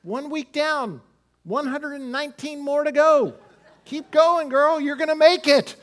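pep talk rising to a rally; cheering daughter on. Retold or imitated. Father on the phone to his college daughter, building to an encouraging cheer. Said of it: "I said".

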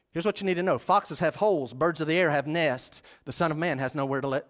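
ordinary delivery

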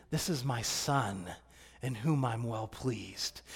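slow, soft, tender murmur; tender hush. Acted. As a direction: say it like a loving father's murmur. Repeats the verse tenderly.

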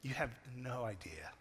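dad quietly lamenting what his sleeping sons missed; wistful hush. Retold or imitated. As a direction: let it fall away softly, wistful. Dad's wistful second thought.

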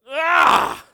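loud, high cry of frustration; mock anguished cry. Pastor acting his teenage torment, crying out. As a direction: wail it out, hammed-up anguish. Overwhelmed by the gift tag.

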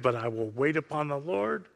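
soft, low-key acted resolve; quiet trust. Psalmist yielding to the Lord, in quiet trust. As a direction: settle down softly after the outcry.

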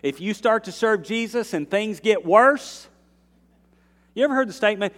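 loud, high, driving preacher's build; full-force preaching. Acted. Pastor urging the church, loud preaching. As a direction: preach it out to the congregation.

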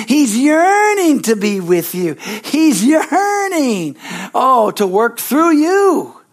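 high, very slow, drawn-out cry of longing; fervent cry. Acted. Preacher pleading with the congregation, fervent high cry. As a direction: stretch out "yearning" as if aching for them.

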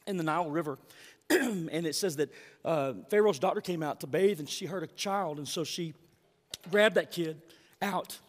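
normal talking voice

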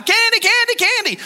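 very high whiny candy chant; mock kid begging in the aisle; whining child. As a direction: whine it like a toddler. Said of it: "a kid is screaming their heads off".

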